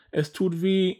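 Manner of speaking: high, plaintive whine of pain; whining. A narrator's reading. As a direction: moan like a small hurt bird. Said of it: "jammerte der Vogel".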